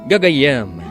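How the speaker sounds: normal talking voice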